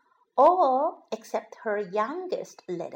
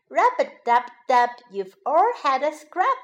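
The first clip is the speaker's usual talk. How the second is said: plain reading voice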